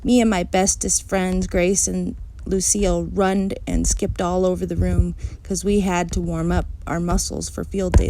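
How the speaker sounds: ordinary delivery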